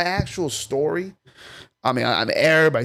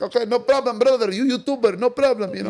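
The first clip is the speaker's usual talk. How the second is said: put-on higher, Arabic-accented halal cart guy; 9 semitones higher, 3 dB louder than usual. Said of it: "He's like".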